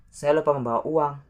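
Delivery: fast, lifted example sentence; quick natural repeat. Shown as a demonstration. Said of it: "Let's say the example".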